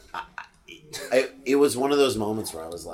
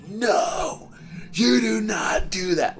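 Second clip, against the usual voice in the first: put-on high, loud scolding yell at a kid; furious yell; 13 semitones higher, 4 dB louder, much slower than usual. Dad yelling across the gym at a bullying kid, loud furious shout. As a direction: bellow at him to stop right now. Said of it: "I fucking yelled so loud".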